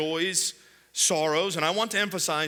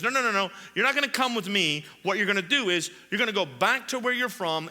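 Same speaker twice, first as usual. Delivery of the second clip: quick, higher-pitched retold refusal; mock brisk refusal; 4 semitones higher, faster than usual. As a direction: imitate waving off a request, fast and insistent. Jesus voiced refusing the man's request.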